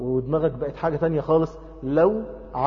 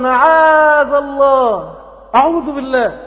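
high, loud cry with long-held vowels; emphatic refusal; 9 semitones higher, 6 dB louder, much slower than usual. Acted. Joseph refuses the governor's wife, emphatic cry. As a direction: cry out for refuge in God.

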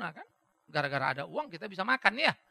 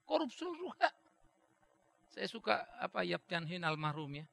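ordinary delivery

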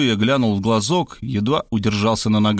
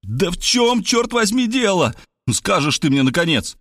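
exasperated shout; 8 semitones higher than usual. A narrator's reading. Rob finally loses it and yells at his friend. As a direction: burst out at last, nearly screaming. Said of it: "я не выдержал и закричал".

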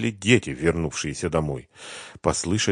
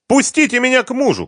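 very high, loud cry; frantic high woman's yell; shouting wife; 9 dB louder than usual. A narrator's reading. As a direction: shout to be let through a door. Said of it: "кричала из-за двери жена".

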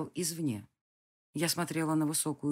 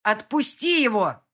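higher, loud, slow order; loud command; 5 semitones higher, 9 dB louder than usual. Acted. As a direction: make the beast hear it. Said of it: "произнесла так громко". (громкий приказ)

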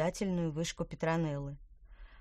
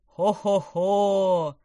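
booming ho-ho-ho; 11 dB louder, much slower than usual. Acted. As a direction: boom out a jolly laugh. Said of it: "пробасил он".

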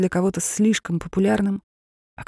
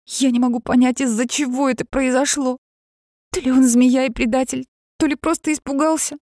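high, loud rushed venting; words tumbling out, upset; rattling on; 8 semitones higher, 4 dB louder than usual. A narrator's reading. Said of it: "тараторила сестра".

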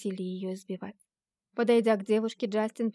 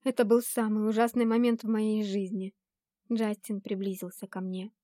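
ordinary delivery, nothing marked

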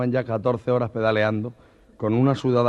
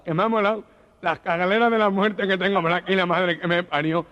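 shaken gangoso; 8 semitones higher than usual. Acted. Gangoso shaken after the ride.

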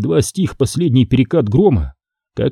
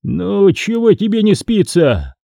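higher-pitched sleepy grumble, lightly acted; grumbling; 8 semitones higher than usual. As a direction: grumble at whoever woke you. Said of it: "заворчал". (ворчливо, повышенным голосом)

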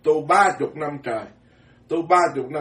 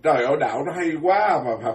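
usual speaking voice

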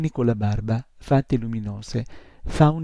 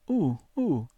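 moaning; 5 semitones higher than usual. A narrator's reading. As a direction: moan it out, dismayed. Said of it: "gemette l'incubino". (gemito lamentoso, acuto)

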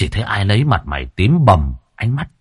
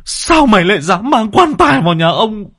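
stammering start bursting into a high, loud threat; furious high-pitched confrontation; teeth-gritted rage; 10 semitones higher, 4 dB louder than usual. A narrator's reading. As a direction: grit your teeth, then explode at him. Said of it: "nghiến răng nghiến lợi".

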